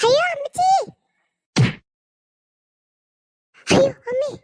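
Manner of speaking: high, loud cry for mother; terrified wail; falling scream. Acted. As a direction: scream as you tumble down. Falling from the tree.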